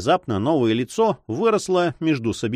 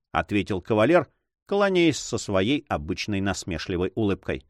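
usual speaking voice

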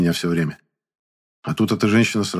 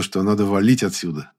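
usual speaking voice

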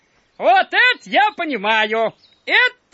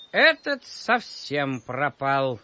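smug; 12 semitones lower, 7 dB quieter than usual. Acted. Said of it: "голос у дракончика самодовольный".

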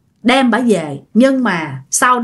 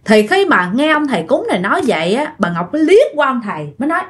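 ordinary delivery

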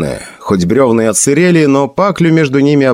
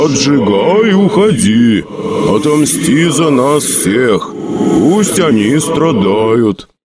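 slow ghostly father voice; 2 semitones higher, slower than usual. Acted. Голос призрака отца.

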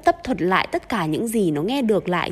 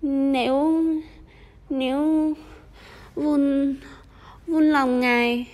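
higher, stuttering and lisping in terror; stammering in fear; 5 semitones higher, slower than usual. A narrator's reading. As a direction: trip over the words, terrified. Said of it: "run như cầy sấy, nên nó vừa nói nhịu vừa nói ngọng".

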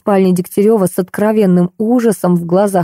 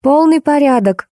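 higher-pitched call without real shouting; 7 semitones higher than usual. A narrator's reading. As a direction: call it up and out, mildly. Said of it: "крикнула". (негромкий высокий окрик)

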